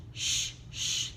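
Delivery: soft unvoiced sh, sh; hush-like sh sound; teacher modeling the sh sound; letter sound sh. Shown as a demonstration. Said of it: "S H".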